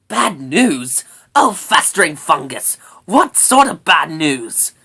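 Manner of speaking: loud, grumpy little creature; exasperated brownie. A narrator's reading. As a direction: demand the news with exasperation. Brownie Sorrel exclaims at bad news.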